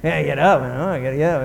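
imitating a grumbler getting up; moaning and groaning. Complainer facing the workday, moaning. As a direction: moan it to yourself, full of self-pity. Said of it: "people moaning and groaning about everything".

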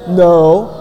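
ordinary talk, nothing marked

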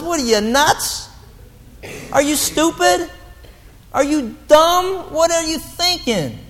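mock-exasperated apostle; incredulous rebuke. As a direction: scold them like Paul would, stretching each word. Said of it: "And Paul says".

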